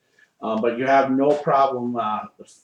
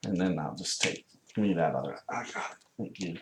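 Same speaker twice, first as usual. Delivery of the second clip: hushed; 5 semitones lower, 8 dB quieter, faster than usual. Real, not acted. Moving minis, hushed aside.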